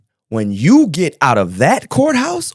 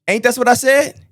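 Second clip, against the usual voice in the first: higher, triumphant I-told-you-so; excited; 10 semitones higher than usual. Real, not acted. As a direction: crow that you called it. Judge echoes his earlier point.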